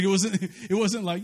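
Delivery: ordinary delivery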